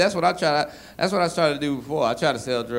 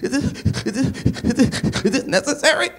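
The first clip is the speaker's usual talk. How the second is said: higher, whiny hurt voice; hurt plea; 10 semitones higher than usual. Retold or imitated. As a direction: plead with the booing crowd. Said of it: "I'm like".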